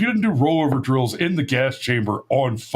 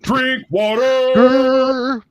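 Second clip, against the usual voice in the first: high, very slow, drawn-out sergeant bark; drill sergeant voice; 12 semitones higher, 5 dB louder, much slower than usual. Pretend. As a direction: stretch it like a hammy drill sergeant. Drawn-out mock sergeant echo.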